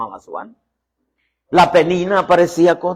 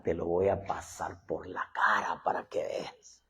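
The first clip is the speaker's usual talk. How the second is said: whisper; 10 semitones lower, 13 dB quieter than usual. Acted. Ana's spiteful vow, hushed.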